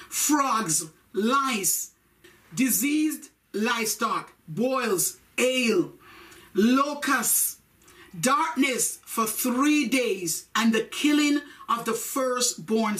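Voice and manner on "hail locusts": normal talking voice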